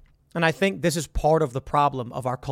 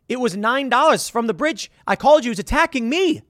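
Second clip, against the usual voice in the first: pitched up and pushing, protesting to the cops; retold exasperated protest; 8 semitones higher, 6 dB louder than usual. Protesting to the police about the fare, pitched-up exasperation. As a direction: plead your case, higher and more frantic with each line.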